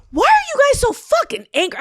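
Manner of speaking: high-pitched, squealed outburst; incredulous high rant; mock exasperation. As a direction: demand it in comic disbelief, voice shooting up. Mock rant at angry ghosts.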